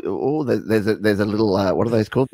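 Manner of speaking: ordinary talk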